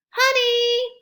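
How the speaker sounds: high, loud sing-song cheese; group photo cheer. A narrator's reading. Whole family saying cheese for the photo, high and loud. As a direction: call it out for the camera. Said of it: "they all said".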